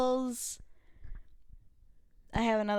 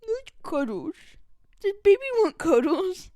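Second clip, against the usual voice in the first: put-on a lightly raised cooing baby talk; 7 semitones higher, 5 dB louder than usual. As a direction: coo gently to a baby. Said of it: "I'd be like".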